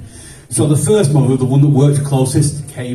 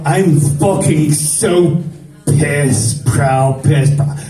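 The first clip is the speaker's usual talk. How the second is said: torn between angry and proud; much slower than usual. Retold or imitated. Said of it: "she was kinda like".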